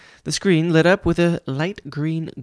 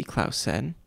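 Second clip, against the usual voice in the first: ordinary delivery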